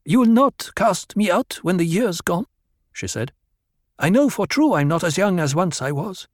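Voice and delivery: put-on high, anxious young woman; Harah's voice. A narrator's reading. Harah asking Paul not to cast her out, high voice. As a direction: lift it high, worried she'll be cast out.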